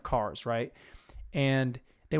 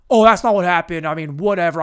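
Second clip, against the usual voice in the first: loud mock-defensive burst; more aggro; 8 dB louder than usual. Guy cuts in to defend himself, loud aggro imitation. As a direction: cut in loudly, hammed-up aggro guy. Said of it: "I made it more aggro as the story went on".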